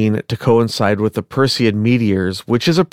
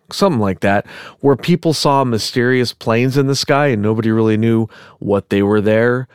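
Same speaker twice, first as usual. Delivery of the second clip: ordinary talk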